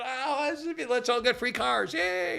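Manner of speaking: high-pitched imitation of eager cheering; soft, high mock yay; mock delight. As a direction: sarcastically play the thrilled freeloader. Mimics voters delighted by free stuff.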